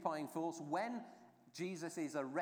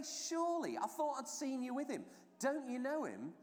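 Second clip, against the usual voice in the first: much higher-pitched incredulous questioning; insistent disbelief; 9 semitones higher than usual. Acted. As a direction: press him as a doubting bystander, voice pitched right up. Bystanders insisting they saw Peter.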